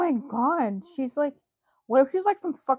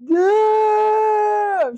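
excited shout; 9 semitones higher, 5 dB louder than usual. Real, not acted. Reacting to the scream.

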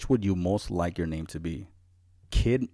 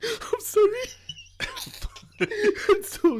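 laughing. Real, not acted. Laughing apology.

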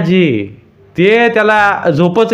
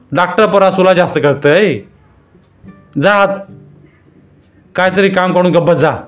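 louder, higher furious tirade; angry husband; 6 semitones higher, 4 dB louder than usual. A narrator's reading. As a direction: berate her, order her inside. Husband berates wife at length.

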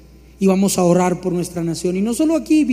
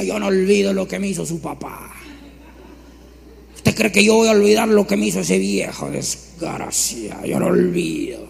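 lightly voiced grudging old woman; a hint of a resentful mother; bitter old mother; 4 dB quieter than usual. Preacher imitating a bitter mother who won't forgive, lightly voiced.

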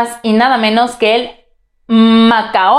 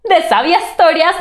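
cheery greeting; 8 semitones higher than usual. Real, not acted. Host's cheery greeting opening.

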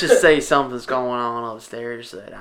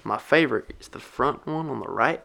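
mock Southern country accent; 4 dB louder than usual. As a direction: play a folksy country bumpkin. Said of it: "rest of the podcast with country accents".

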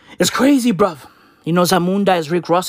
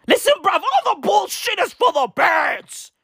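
comic outburst; 12 semitones higher, 3 dB louder than usual. Pretend. Ranting over a squawking bird, comic high shout. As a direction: yell it high and stretched out.

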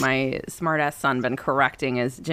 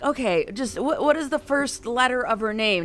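exasperated; 7 semitones higher than usual. Retold or imitated. Mom pushing son.

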